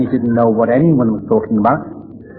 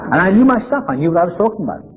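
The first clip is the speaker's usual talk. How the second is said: mock self-assured boast, pitched much higher; mock cocky; 8 semitones higher, faster than usual. Recovering drinker mocks his younger know-it-all self, cocky. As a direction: puff up like the know-it-all he was.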